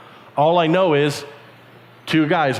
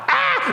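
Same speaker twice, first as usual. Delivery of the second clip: squeal of joy. Acted. Joyful shriek.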